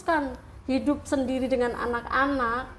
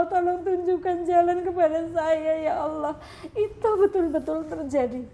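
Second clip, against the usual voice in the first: higher, tearful voice of remembered prayer; tearful pleading; 5 semitones higher than usual. Real, not acted. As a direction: voice rising into real crying. Tearful retelling of her prayers.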